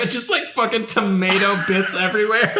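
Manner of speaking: high, laughing disbelief. Real, not acted. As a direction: talk through your own laughter. Cracking up over the sandwich.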